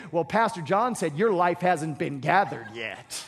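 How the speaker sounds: a hint of wifely reproach, pitched low; lightly voiced nagging wife. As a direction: lightly act out a wife lecturing her husband. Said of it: "do not turn to them and say".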